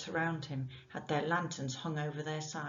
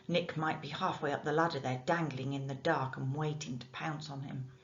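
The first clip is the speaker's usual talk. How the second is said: plain reading voice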